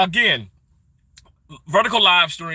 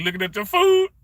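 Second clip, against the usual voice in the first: ordinary talk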